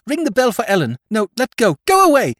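high, loud, rising to a shriek on Go away; near-hysterical cry. Acted. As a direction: shove him off with your voice. Sister in hysterics.

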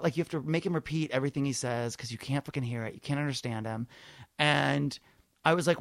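normal talking voice

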